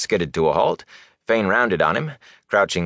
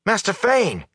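high-pitched urgent call; shouted; 14 semitones higher than usual. A narrator's reading. Young man hailing the missing peddler, shouted. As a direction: call out to someone getting away. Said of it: "he shouted".

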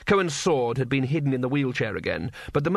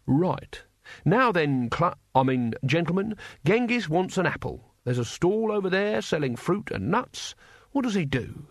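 higher, singsong classroom voice; schoolmaster quizzing pupils; 4 semitones higher than usual. Acted. Mister Saveloy quizzes his horde on shopping, schoolmasterly. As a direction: set out the exercise like a patient teacher.

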